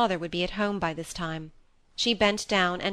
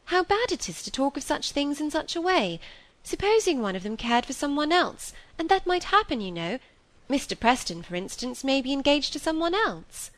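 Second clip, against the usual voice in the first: higher-pitched, earnest protest; mildly exasperated young woman; vexed; 8 semitones higher than usual. A narrator's reading. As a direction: argue the point, flushed with vexation. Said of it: "still flushed by vexation".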